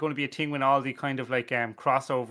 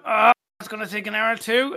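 smug worker; 7 semitones higher, 7 dB louder than usual. Retold or imitated. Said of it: "I kick off a building".

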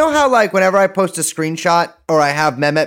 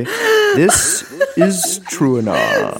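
very slow, drawn-out higher announcement; goofy announcer; 4 semitones higher, much slower than usual. Pretend. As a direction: stretch every word like a silly announcer.

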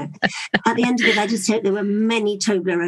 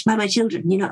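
usual speaking voice

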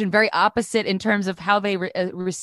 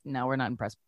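low, quiet imitated brush-off; deadpan panel verdict; unimpressed; 7 semitones lower, 11 dB quieter, faster than usual. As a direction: turn them down softly in a dropped voice. Retelling panel's unimpressed rejection.

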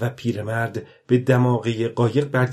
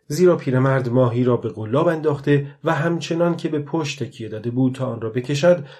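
ordinary delivery, nothing marked

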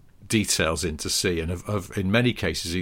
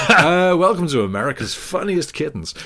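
mock TV host; 8 semitones higher, 7 dB louder than usual.

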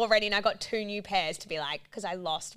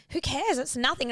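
high-pitched mock dismissal; imitated brush-off; 6 semitones higher than usual. Partner brushing off her fretting at home, imitated brush-off. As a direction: imitate someone waving the worry away.

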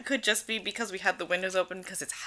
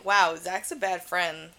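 dry aside; 2 semitones lower, 3 dB quieter, slower than usual. Retold or imitated. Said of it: "And I'm like".

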